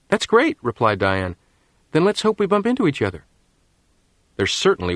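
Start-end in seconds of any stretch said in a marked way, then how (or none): 0.1-3.3 put-on girl voice. A narrator's reading. Diane voiced in story.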